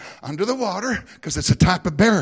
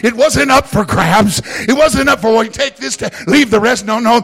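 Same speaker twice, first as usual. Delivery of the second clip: louder, driving sermon delivery; full-force preaching; 5 semitones higher, 8 dB louder than usual. Real, not acted. Pastor to the congregation, full-force preaching. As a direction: preach it out to the whole house.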